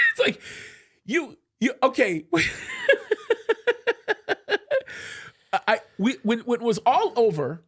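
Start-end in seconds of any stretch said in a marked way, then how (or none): 2.3-5.2 long, high, stuttering laugh; real laugh. Real, not acted. Storyteller amused by the absurd rebuke, long high laugh. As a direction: crack up in a run of high giggles.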